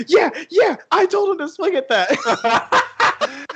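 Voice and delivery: very high, excited mock coach yell. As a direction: holler it triumphantly across the dugout, imitating a proud coach. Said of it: "He's, like, yelling at our other bench coach".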